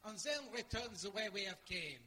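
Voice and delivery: theatrical French-accented announcement. Shown as a demonstration. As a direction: declaim like a triumphant Frenchman. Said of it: "French, that's right".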